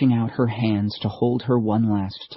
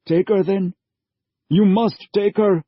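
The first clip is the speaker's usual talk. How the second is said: higher, louder, urgent father voice; father pleading, raised and strained; desperate father; 10 semitones higher, 5 dB louder than usual. A narrator's reading. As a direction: push her into the stranger's arms. Father gives his daughter up.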